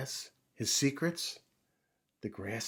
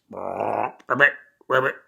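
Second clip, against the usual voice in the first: booming ribbits from the frog; loud frog croak; 10 dB louder than usual. Acted. Frog showing off her voice, loud croaking. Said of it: "and what a voice".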